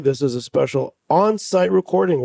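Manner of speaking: ordinary delivery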